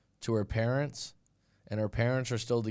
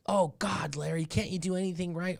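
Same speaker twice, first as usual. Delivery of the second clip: higher, exasperated nagging wife voice; 7 semitones higher than usual. Retold or imitated. Wife belittling husband Larry at dinner, higher nagging voice. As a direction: scold him like a fed-up wife. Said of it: "she would just".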